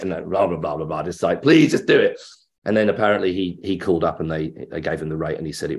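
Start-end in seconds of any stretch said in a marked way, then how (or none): 1.4-2.2 loud, high mock begging; pleading. Fan begs the actor to take the part, pleading. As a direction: burst out in an exasperated plea.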